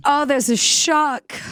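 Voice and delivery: high, slow Mid-Atlantic mock alarm; Mid-Atlantic accent. Castaway spotting a shark, theatrical Mid-Atlantic accent. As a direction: draw it out in old-film Mid-Atlantic. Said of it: "speaking in this different accent".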